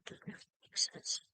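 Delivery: quick breathy whisper, almost to herself; whispered aside. Real, not acted. Crafter whispering an aside about her collage. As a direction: let it slip out as a fast whisper.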